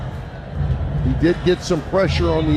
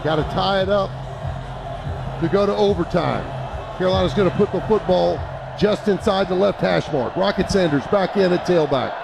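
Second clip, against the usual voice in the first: fast, raised radio play-by-play; live play-by-play; 5 semitones higher than usual. Real, not acted. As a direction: call the play as it happens.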